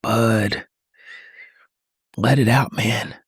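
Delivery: low, hushed, slow comfort; tender reassurance. Acted. Soothes the upset child.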